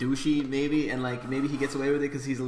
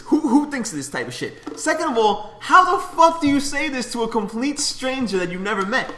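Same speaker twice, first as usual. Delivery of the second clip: high, loud exasperated protest; embarrassed indignation; 7 semitones higher, 5 dB louder than usual. Real, not acted. Telling his co-hosts how embarrassed he was, exasperated. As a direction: protest it, incredulous and mortified. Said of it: "I'm just so embarrassed".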